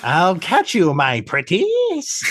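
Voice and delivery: witchy villain. Retold or imitated. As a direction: croon the threat like a wicked witch laughing. Vadic voiced as witch-like villain.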